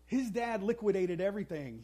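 usual speaking voice

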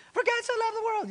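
put-on high sing-song child reciting; imitating a child. Dad imitating his kids reciting John three sixteen, high. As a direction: pipe it up like a little kid reciting. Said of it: "they had John three sixteen".

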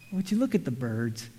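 low, soft, teasing aside; mocking question. Jesus teasing his listeners, soft and mocking. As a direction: gently rib the crowd with it. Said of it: "it's almost like he's mocking us".

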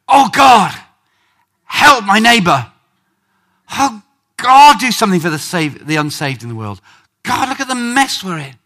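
loud, high outcry to God, acted; anguished pleading prayer. Preacher showing how to pray for the unsaved, loud anguish. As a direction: plead as if birth pangs are hitting. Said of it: "The idea is go".

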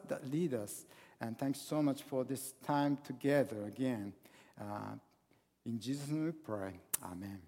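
soft, hushed prayer that fades away at the amen. Acted. Leads the opening prayer.

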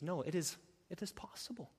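hushed, breathy whisper of hope; whispered promise. Acted. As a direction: gently assure them it's possible. Jesus reassuring softly.

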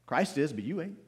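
ordinary talk, nothing marked

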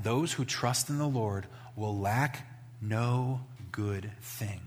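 slow, soft reverent reading; a pastor reading a psalm slowly, quietly; scripture reading. Acted. Rereads verse ten.

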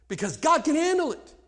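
ordinary delivery

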